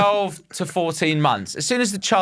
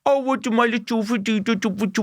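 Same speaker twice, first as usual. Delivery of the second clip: put-on baby talk to pet; 8 semitones higher than usual. Shown as a demonstration. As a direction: coo at a little pet. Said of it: "I'm talking about, like".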